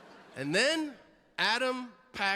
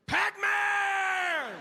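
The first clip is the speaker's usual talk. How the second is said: high, drawn-out shout of a name; imitated excited fan yell; calling out; 4 dB louder than usual. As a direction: holler it across the room, stretched long. Fan calls out to the player.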